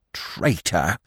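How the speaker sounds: ordinary delivery, nothing marked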